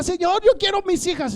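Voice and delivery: high, loud retold plea; crying out to God. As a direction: reenact a fervent cry to the Lord. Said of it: "empecé a clamarle al Señor".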